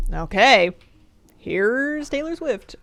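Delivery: a lightly voiced, slow, drawn-out smart speaker okay; dutiful speaker voice. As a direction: play the reluctant cowboy assistant. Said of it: "his two modes for, um, agreeing".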